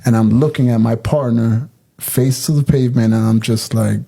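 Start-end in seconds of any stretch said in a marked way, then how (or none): none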